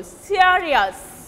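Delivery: "serious" with a drawn-out, stressed SE; first-syllable stress. Shown as a demonstration. Teacher repeating the stress rule, SE strong in "serious". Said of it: "will take stress on the first syllable".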